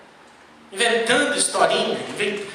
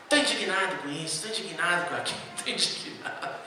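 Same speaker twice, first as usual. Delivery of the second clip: muttered mock indignation, much softer; mock grumbling; 8 semitones lower, 11 dB quieter, faster than usual. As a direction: grumble it low and petty, half laughing. Mocking an indignant person. (resmungo indignado e debochado, bem mais baixo)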